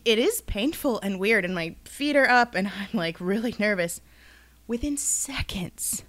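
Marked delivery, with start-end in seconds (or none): 4.7-6.0 unvoiced, slow whisper. Telling friends the twist, slow secret whisper. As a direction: hush it like a secret. Dramatic aside.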